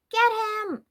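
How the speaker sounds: high, loud rallying shout; rally the troops with a cry. A narrator's reading. Girl urging her friend to attack, loud high shout. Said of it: "Lucy shouted".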